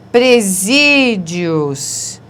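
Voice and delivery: Presídios stretched very slowly; drawn-out word. Shown as a demonstration. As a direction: spell Presídios out slowly for the class. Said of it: "paroxítona com encontro vocálico". (Presídios bem devagar)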